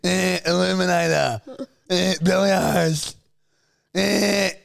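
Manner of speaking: high-pitched animal bleat with words; mimicked koala making conversation. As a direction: talk like the dying koala, squeaky-high. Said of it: "sounds like the koala's dying".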